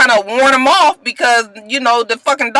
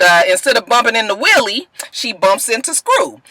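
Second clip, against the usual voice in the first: ordinary delivery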